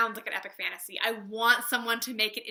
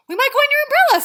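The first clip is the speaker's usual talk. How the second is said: much higher and louder, nearly shouting the repeat; insistent Pooh; 17 dB louder, much faster than usual. Acted. Reader voicing Pooh's third insistent repeat, near shout. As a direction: Pooh losing patience and insisting. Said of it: "said Pooh".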